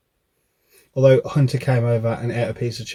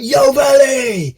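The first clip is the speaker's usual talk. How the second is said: loud, slow, high shout; shouted slogan; 13 semitones higher, 7 dB louder, much slower than usual. Retold or imitated. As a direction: belt it out like an advert jingle. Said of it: "it was like".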